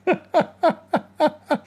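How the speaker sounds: a real burst of high laughter. Real, not acted. Laughing at the journalist's cart video, real laughter.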